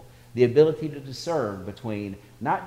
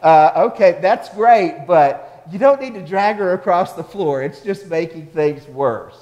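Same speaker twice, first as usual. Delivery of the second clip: lightly acted protest, raised and loud; higher, louder imitation of a flustered parent; mock exasperated mother; 6 semitones higher, 10 dB louder than usual. Mother correcting her well-meaning son, mock exasperation.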